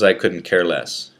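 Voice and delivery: plain reading voice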